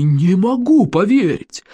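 theatrical incredulous cry; amazed disbelief. A narrator's reading. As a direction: drag the words out, utterly astounded. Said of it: "выпучив глаза в изумлении".

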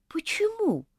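high, whispered surprised question; puzzled, ask it under the breath. Acted. Rosemary whispers her surprise to Elizabeth. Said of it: "удивилась Розмари".

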